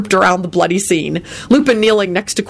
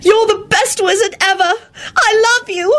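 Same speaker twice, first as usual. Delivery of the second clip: gushing tearful. A narrator's reading. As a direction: gush love hysterically.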